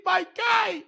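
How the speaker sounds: high excited yell; hype shout. Real, not acted. Hyped over a great throw.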